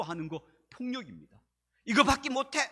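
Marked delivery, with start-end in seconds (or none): 1.8-2.7 sharp yell, higher and louder; mock shout. 부하 직원에게 소리치는 상사의 고함. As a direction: bark it like a harsh boss. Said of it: "소리치는 것도 폭력입니다".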